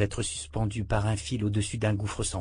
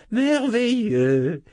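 much higher, slow and loud; delighted exclamation; 12 semitones higher, 6 dB louder, much slower than usual. A narrator's reading. Old wizard praises the meal again, rising with delight. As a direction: let the delight burst out the second time.

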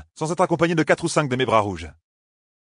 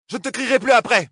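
raised, high shout; 9 semitones higher, 4 dB louder than usual. Acted. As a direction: yell out the vow. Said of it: "cria Nineveh".

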